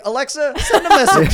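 squeaky laughing voice command; giggly high command. Retold or imitated. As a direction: call to the speaker through laughter. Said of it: "he's like".

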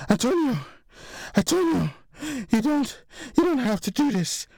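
high-pitched desperate woman's plea; pleading. A narrator's reading. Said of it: "she said, pleading with him".

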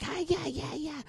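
faint, high German squeak. Retold or imitated. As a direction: imitate a tiny German far in the background. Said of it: "like, German voice or whatever".

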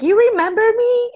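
put-on eager kid. Retelling students greeting the teacher, high eager mock question. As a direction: ask it like a kid hoping to be remembered. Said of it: "they're like".

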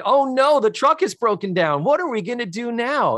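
high, hammed-up theatrical alarm; mock panic. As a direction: fake distress like a theme park actor. Mimics theme park ride performers.

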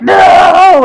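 long, loud, high "no" of dismay; hammed-up crowd despair; mock anguished shout. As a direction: wail it like a crushed fan. Acts out crowd losing the final.

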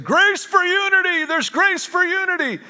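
high-pitched, sustained cry; excited proclamation. Retold or imitated. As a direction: call it out high, holding the notes. Jumped up proclaiming a revelation.

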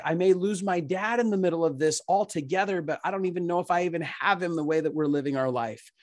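retold fear. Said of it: "they were so scary".

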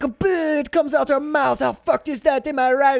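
high hammed-up director voice; excited director. Director pitching tentacle shot to actor, hammed-up.

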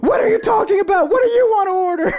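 high laughing yell in a mock accent; imitating yelling. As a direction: shout it while cracking up.

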